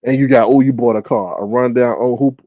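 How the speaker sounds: ordinary delivery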